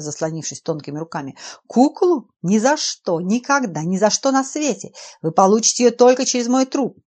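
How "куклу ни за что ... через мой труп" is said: loud, high, outraged refusal; furious defiant shouting. Acted. Professor defies the intruder.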